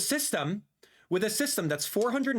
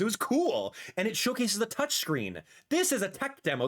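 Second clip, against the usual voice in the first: ordinary delivery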